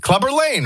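high-pitched LANG with a long "ay"; Lang said as lane. Retold or imitated. Host protests he said the name, LANG like "lane". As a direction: insist on LANG sounding like "lane". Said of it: "I said".

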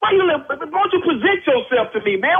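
real anger at a stranger over the phone; heated confrontation. Real, not acted. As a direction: get in his face and demand his name. Angry neighbour confronts the caller.

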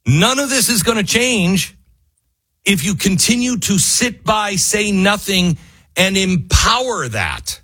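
high-pitched frustrated rant, pressing each phrase; frustrated yelling. Real, not acted. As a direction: plead with listeners through frustration. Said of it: "I don't mean to yell at you".

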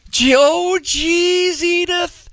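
high put-on Archie Bunker voice. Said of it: "that's a little better".

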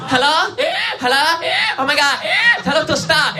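very high-pitched mock panic; panicked Korean mom. Mom picks up beside the answer machine, panicked Korean mom. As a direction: imitate a mother losing her mind at phone feedback. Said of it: "It becomes a crisis".

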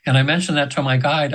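ordinary delivery

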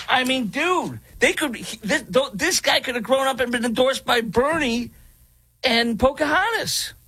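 high, incredulous mockery; mocking. Real, not acted. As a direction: scoff at it in a high voice. Mocking the Democrat candidate.

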